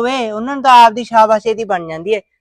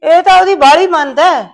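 high, loud accusing cry; angry outburst; 5 semitones higher, 7 dB louder than usual. Acted. Mother scolding her son for trusting Preet, loud and high. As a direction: blast it at him, pitch up.

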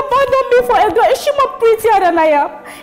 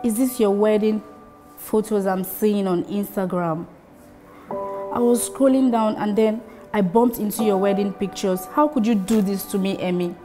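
hurt and quiet; 5 semitones lower, 7 dB quieter than usual. Acted. Hurt girlfriend opening.

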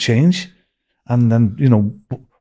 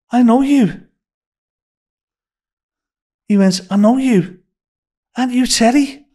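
imitating a startled chef, much higher and louder; surprised recognition; 12 semitones higher, 4 dB louder than usual. Chef recognising the narrator from back home, excited higher voice. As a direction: act out an old friend suddenly placing you.

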